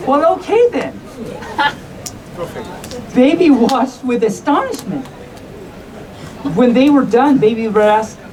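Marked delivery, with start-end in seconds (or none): none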